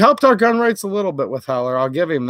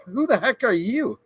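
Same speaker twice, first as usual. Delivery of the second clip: high scoffing senator voice; mocking dismissal; 5 semitones higher, 4 dB quieter than usual. Senators laughing off a constituent, scoffing. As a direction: sneer it like a smug politician. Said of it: "they laugh and they say".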